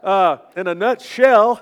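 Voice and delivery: emphatic. Shown as a demonstration. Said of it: "I really sound like a hillbilly now".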